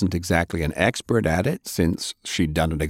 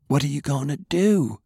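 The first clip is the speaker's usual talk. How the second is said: put-on young girl, hushed; 8 semitones higher than usual. A narrator's reading. Girl voiced in story.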